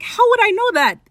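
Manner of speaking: high, loud, exasperated retort; hammed-up "how should I know"; mock indignant. Acts out Roach's indignant retort.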